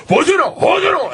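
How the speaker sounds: very high shouted pretend Russian; frantic, high-pitched mock police shout; mock yelling. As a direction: imitate cops yelling back. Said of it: "the cop starts yelling back".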